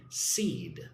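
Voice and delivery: "Seed" isolated, low and falling; stressed syllable alone. Shown as a demonstration. Teacher showing learners the stressed syllable, "Seed" said on its own. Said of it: "stress on the second syllable".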